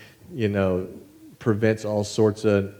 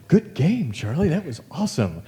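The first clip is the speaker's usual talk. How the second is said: imitating gracious friend; 6 semitones higher than usual. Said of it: "beat me and say".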